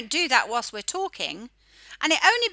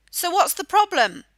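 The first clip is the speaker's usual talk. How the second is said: usual speaking voice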